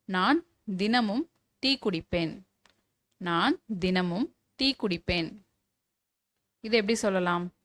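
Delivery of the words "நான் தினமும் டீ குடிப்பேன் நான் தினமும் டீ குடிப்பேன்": slow dictation. Shown as a demonstration. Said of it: "teacher dictates a model sentence".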